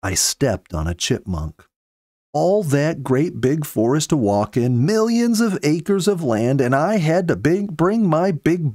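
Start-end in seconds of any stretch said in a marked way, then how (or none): none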